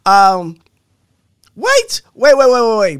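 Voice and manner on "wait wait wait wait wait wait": excited wait. Real, not acted. Stopping to call a halt.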